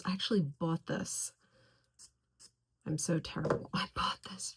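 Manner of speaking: hushed, playful self-scolding; guilty whisper. Real, not acted. To viewers about keeping her mother's perfume, a guilty whisper. Confessing she kept mother's gift.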